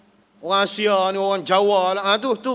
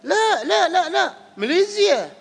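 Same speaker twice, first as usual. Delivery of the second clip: high, put-on voice insisting "la, la, la"; comic mock protest "no, Malaysia"; imitating a Malaysian protest; 7 semitones higher than usual. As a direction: play the pilgrim who won't be called ajam. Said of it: "Kita kata".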